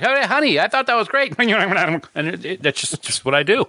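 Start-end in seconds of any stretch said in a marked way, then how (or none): 0.0-1.3 sing-song to his wife. Retold or imitated. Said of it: "I'll be like".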